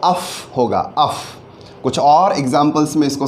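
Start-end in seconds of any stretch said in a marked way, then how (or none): 0.0-1.1 अफ said slowly as a short uff; teacher isolates the sound अफ, crisp and clear; the ough sound. Shown as a demonstration. Teacher isolating the sound for the class, clear demo. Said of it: "O यू जी H का pronunciation है वो".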